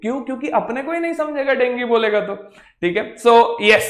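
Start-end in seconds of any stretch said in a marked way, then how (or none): none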